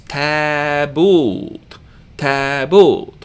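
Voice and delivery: Tabult with both vowels held long, taa-buult; two long syllables. Shown as a demonstration. Teacher demonstrating two long syllables, drawn-out tabult. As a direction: stretch both halves of "tabult", rising onto the second. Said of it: "here we have two long syllables".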